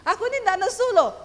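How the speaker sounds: loud, high, quick protest; angry-sounding denial. Retold or imitated. Wife denying she is cross, high loud protest. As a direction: fire back a heated denial. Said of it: "Itu nadamu tuh nada wong nesu".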